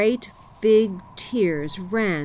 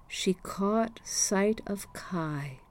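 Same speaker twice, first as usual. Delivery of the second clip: slow storyteller's whisper; 7 dB quieter, much slower than usual. A narrator's reading. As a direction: hush it down, slow and secret. Hushed moment in the story.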